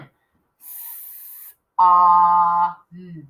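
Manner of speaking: "S, ah, mm" as long separate sounds; sounding out. Shown as a demonstration. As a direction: hold a hiss, then "ah", then "mm". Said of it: "I'm writing it kindergarten way".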